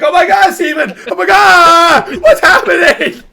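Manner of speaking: hammed-up commentator screaming at the top of his range; Greg Olsen. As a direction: yell in drawn-out, high-pitched disbelief. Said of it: "He's like".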